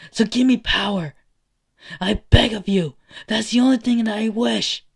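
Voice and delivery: loud plea climbing to a high shout; desperate begging. Acted. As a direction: build from firm wish to all-out cry. Boy begs for power to save everyone.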